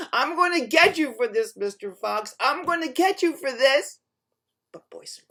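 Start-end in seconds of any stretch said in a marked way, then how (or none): 0.1-4.0 loud, raised threat; bear yelling. A narrator's reading. Bear threatens the fox who tricked him, yelling. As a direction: yell it as an outraged threat. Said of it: "hear that bear yell".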